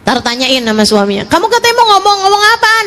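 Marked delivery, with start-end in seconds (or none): 1.3-2.8 lightly acted, higher-pitched calling voice; husband calling out. Husband asking his bathing wife what she wanted, calling out. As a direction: call out to someone in another room.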